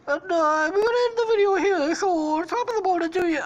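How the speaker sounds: put-on high, quick, botched British accent sliding into Irish; mock British-turned-Irish accent. YouTuber signing off to viewers, mock British-Irish accent. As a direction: attempt British and land on Irish. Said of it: "Oh wait, that's fucking Irish".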